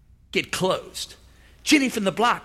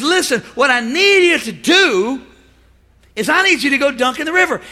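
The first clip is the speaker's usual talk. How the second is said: loud, urgent order from the prophet; forceful command; 6 semitones higher, 6 dB louder than usual. Acted. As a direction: bark out the order like a stern prophet.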